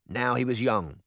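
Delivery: plain reading voice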